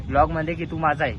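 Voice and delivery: ordinary talk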